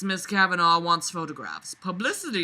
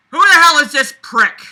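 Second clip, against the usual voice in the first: loud and higher, indignant; hammed-up jealous anger; jealous outburst; 10 semitones higher, 15 dB louder than usual. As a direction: snarl it possessively. Acting Grey's jealous thought.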